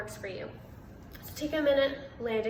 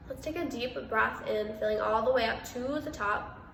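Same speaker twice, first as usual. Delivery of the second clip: measured meditation voice with long pauses; guided meditation; 4 dB quieter than usual. Real, not acted. Meditation host guiding listeners through breath and body awareness, calm and spacious. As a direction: guide listeners into stillness, unhurried, pausing between phrases.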